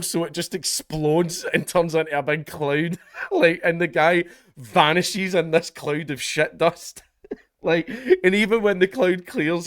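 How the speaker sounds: high, slow, giggling through the story; real laughter breaking the words; laughing. Real, not acted. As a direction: laugh while telling it.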